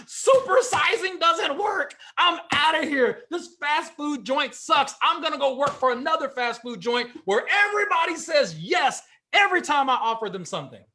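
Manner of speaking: hammed-up tantrum of a worker who got told no; mock angry quitting rant. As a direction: storm out like an offended cashier. Acted fast food worker quitting.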